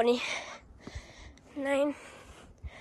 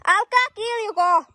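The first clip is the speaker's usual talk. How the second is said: high, sustained call to people away; 8 semitones higher, 4 dB louder than usual. Real, not acted. Rider telling screamers to stop, high calling voice. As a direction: raise pitch and call it out.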